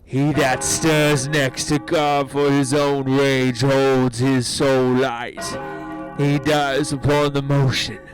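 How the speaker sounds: loud, measured authority; commanding rebuke. Acted. General shames his brawling soldiers, loud commanding rebuke. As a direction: thunder a stern public rebuke.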